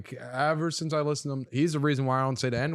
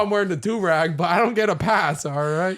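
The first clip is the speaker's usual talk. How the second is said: louder playful protest; mock indignant; 7 semitones higher, 7 dB louder than usual. Real, not acted. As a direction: fake outrage at being set up. Mock defensive protest, laughing.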